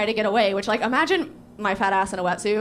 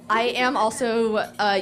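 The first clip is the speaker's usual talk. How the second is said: ordinary delivery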